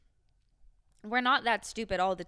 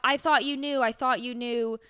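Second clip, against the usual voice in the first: higher, louder mock pleading quote; mock protest; 4 semitones higher, 6 dB louder than usual. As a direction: imitate someone protesting, pitched up and louder. Acted plea from a show character.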